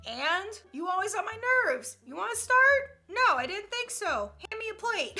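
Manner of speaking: sassy comeback. Acted. Snapping back at someone about the phone, mock sassy. Acted sassy parent-child exchange.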